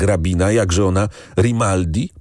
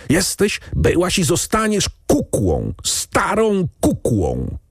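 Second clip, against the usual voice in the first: higher, strangled fury, ending slow; choking with rage; 10 semitones higher than usual. Acted. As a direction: hurl the insult through a tight throat. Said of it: "krztusił się w pasji najwyższej".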